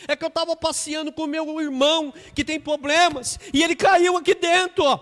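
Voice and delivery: high, very loud pleading cry for help; desperate crying plea. Acted. Boy begs the car owner to save his brother, loud desperate plea. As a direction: beg for help at full voice. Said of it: "Aí o menino chorando".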